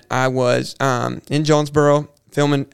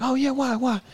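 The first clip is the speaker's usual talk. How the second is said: high, eager and softer, leaning in; mock-eager "why, why?" pitched way up; eager curiosity; 10 semitones higher, 6 dB quieter than usual. Imitating how they begged the photographer to explain. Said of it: "we're like".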